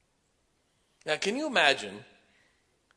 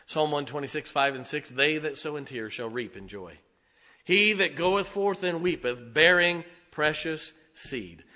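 scripture reading; 3 semitones higher, 6 dB louder than usual. Acted.

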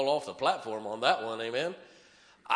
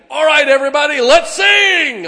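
much higher, shouted call to the room; hammed-up shout to get everyone singing; mock rallying shout; 12 semitones higher, 17 dB louder than usual. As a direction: yell it like a song leader rousing a crowd. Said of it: "I'm not walking in saying".

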